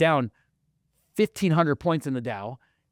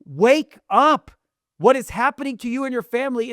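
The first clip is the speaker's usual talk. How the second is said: yelling rant; 8 semitones higher, 3 dB louder than usual. Acted. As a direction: hammer each line at sleepers. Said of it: "I wanna yell".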